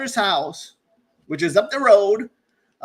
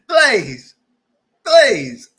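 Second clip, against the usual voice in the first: exasperated; 4 semitones higher, 9 dB louder than usual. Pretend. Host exasperated by the character.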